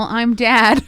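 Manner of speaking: laughing through a dad joke. Real, not acted.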